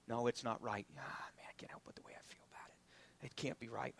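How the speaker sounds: voiced in a hushed whisper; whispered doubt. Preacher voicing a believer's inner doubts, whispered. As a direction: whisper the doubting thoughts to yourself.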